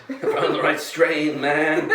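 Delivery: high laughing stoner voice; laughing high-pitched stoner impression; stoner voice imitation. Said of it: "Love your stoner voice".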